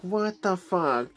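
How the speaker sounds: mock outrage at the movie; exasperated what the fuck. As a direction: stretch it out in disbelief. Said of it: "I'm like".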